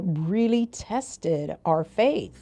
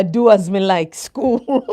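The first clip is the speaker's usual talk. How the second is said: slow, louder Jamaican patois line; Jamaican accent; 7 dB louder, slower than usual. Retold or imitated. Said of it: "I had to go into my accent".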